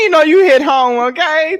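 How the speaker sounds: ordinary delivery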